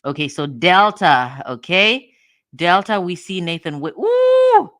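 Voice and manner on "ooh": high, loud, sudden ooh; surprised squeal. Real, not acted. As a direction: squeal at the discovery. Spots a name in records.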